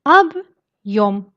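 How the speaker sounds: объём split as "ab", pause, "yom"; separated at hard sign. Shown as a demonstration. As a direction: break объём cleanly after the б. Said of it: "Il ne sert qu'à séparer".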